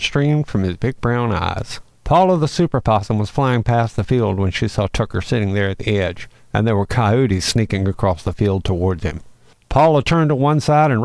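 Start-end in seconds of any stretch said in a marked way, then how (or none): none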